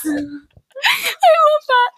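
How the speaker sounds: high squealing laugh through the words; delighted laughing squeal. Real, not acted. Guest laughs at class is in session joke, laughing.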